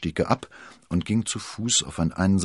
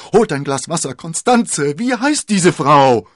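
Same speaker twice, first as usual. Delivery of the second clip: much higher and louder urgent orders; urgent shouting; 12 semitones higher, 13 dB louder than usual. A narrator's reading. D'Artagnan shouting to the friends and Constanze, urgent. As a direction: shout for water and answers. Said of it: "schrie D'Artagnan".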